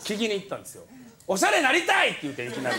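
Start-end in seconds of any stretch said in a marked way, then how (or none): 1.3-2.1 eager blurt. Retold or imitated. Blurting request to fashion mentor.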